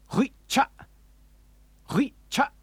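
"Hui cha" high and loud, syllables cut off abruptly; Klingon curse. Shown as a demonstration. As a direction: spit out "Hui cha" and stop dead. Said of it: "Now you can say the Klingon curse".

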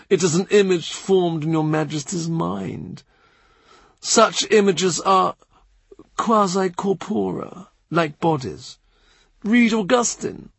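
lightly voiced measured churchman; Cranmer.

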